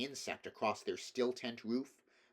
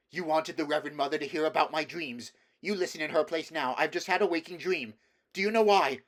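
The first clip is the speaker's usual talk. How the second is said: higher, louder, rushing; a shaken son pouring out his dream; urgent agitation; 6 semitones higher, 9 dB louder, faster than usual. A narrator's reading. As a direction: press the words out feverishly. Boy urgently explaining vision.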